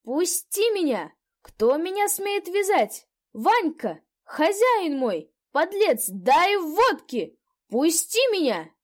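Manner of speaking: put-on hoarse drunk shouting. A narrator's reading. Drunk recruit yells at the men who tied him, hoarse. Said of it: "закричал сиплый голос".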